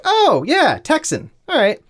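high, drawn-up oh of recognition; retold realization. Recalling hearing the accent in interviews, high-pitched realization. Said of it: "So I was like".

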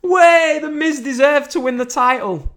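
high, loud cheering fan parody; high mocking fan cheer. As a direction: lampoon a gushing fan.

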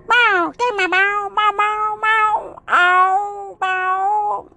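long run of high-pitched yowls; cat yowl imitation. Kitty fed up with dirty litter, yowling. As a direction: meow on and on, high and wailing.

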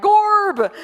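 Gabe turned into "Gorb", high and loud; or-language greeting. Retold or imitated. Greeting the worship director, high, loud, playful "Gorb". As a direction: call out "Gorb" with a big silly OR. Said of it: "every time I see Gabe, I'm like".

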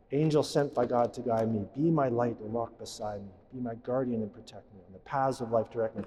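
soft, reverent prayer recitation; recited prayer. Acted. Priest reciting the guardian angel prayer to the congregation, soft and reverent. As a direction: pray it quietly and devoutly.

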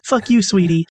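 polite Southern insult; sweet Southern curse. Retold or imitated. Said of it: "Southern hospitality".

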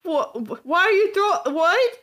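high, loud, stammered disbelief; acted shock, breaking off mid-word; bewildered protest. Victim acted in food fight.

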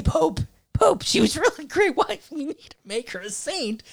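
high, put-on papal voice; Pope impression. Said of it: "that's my Pope impression".

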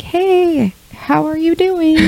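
high, drawn-out mock-innocent hello; high-pitched, overly sweet check-in; faux-casual greeting. Retelling friend's pointedly casual text.

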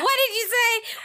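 high-pitched mock challenge; imitating co-host. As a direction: squeal it as a teasing impression. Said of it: "I just hear you go".

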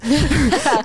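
petit éclat de rire aigu qui retombe; éclate de rire, haut puis descendant. Real, not acted. Rit d'elle-même. (éclat de rire aigu)

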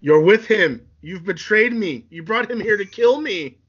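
loud opening, then high and heated; angry accusation. Acted. Anakin accuses Padme of betraying him, heated and high. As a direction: hurl the betrayal at them.

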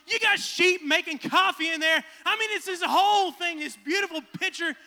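normal talking voice

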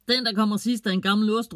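loud, high call while running off; shouting. A narrator's reading. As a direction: yell it as a race challenge. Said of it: "råber hun".

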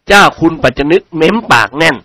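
usual speaking voice

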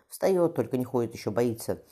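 usual speaking voice

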